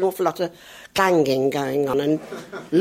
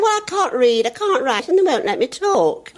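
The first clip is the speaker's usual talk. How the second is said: put-on high child voice, lightly done; little boy; 8 semitones higher than usual. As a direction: play the cheeky four-year-old. Child voice in retold story.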